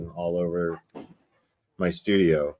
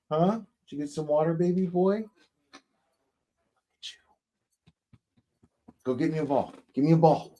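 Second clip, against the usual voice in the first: much higher, sing-song pet voice; 8 semitones higher, faster than usual. Real, not acted. Owner coaxes her dog to fetch a ball, high baby talk.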